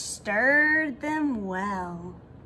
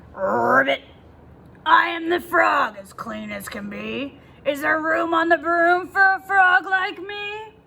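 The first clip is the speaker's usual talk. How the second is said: frog voice; 5 semitones higher, 5 dB louder than usual. Acted. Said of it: "said with a croak".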